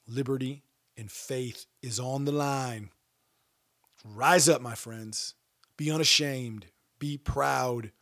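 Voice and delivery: rally the crowd to fight; fiery revolutionary speech. Acted. Rousing call to fight.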